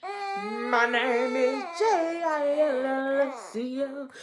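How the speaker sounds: slow, drawn-out spelling of the name. Retold or imitated. Vlogger imitating a singer's spoken intro, slow and drawn out. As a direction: lightly mimic a soul singer's spoken intro.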